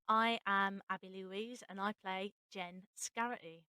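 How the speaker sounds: ordinary talk, nothing marked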